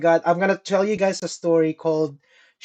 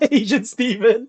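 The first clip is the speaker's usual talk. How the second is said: laughing; 5 semitones higher, 3 dB louder than usual. Real, not acted. Laughing at a friend's mix-up.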